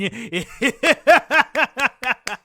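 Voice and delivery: villain laugh. Acted. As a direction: cackle over the victory. Villain laughing after threat.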